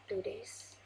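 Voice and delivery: soft unvoiced please; whispered plea. Real, not acted. Gamer pleading with an unseen monster, whispering. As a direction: beg in a whisper.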